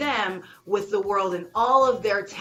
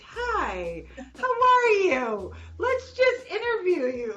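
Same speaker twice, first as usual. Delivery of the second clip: slow, high mock-mellow greeting; teasing imitation of a very mellow friend; mellow impression; 10 semitones higher, slower than usual. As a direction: drawl it out like someone totally unhurried. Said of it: "so mellow all the time".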